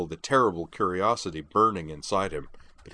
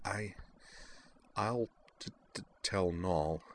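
much softer, slow stammering murmur; 10 dB quieter than usual. A narrator's reading. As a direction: barely breathe the words. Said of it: "she murmured".

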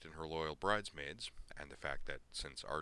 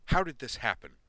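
loud, high, furious demand; angry demand; 8 semitones higher, 13 dB louder than usual. Acted. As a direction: demand an answer, shouting. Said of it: "Corwin demanded".

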